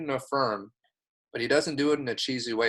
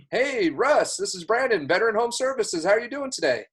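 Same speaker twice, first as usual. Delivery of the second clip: much higher, upbeat sales greeting; picked-up enthusiasm; 9 semitones higher than usual. Shown as a demonstration. Caller opening a home services sales call, enthusiastic. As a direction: hit them with a bright, high-pitched opener. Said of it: "I need to pick up my enthusiasm".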